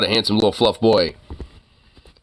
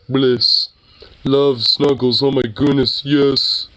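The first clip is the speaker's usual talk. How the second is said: dreamy dog voice; 4 semitones higher, much slower than usual. Retold or imitated. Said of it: "he's just like".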